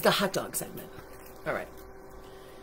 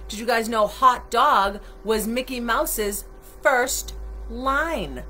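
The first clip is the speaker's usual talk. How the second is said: ordinary delivery, nothing marked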